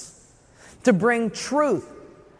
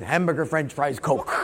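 lower, softer, halting repetition; 7 semitones lower, 3 dB quieter than usual. Retold or imitated. Student repeating the words back, lower and softer. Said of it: "He said".

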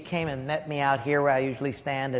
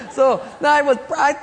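ordinary delivery

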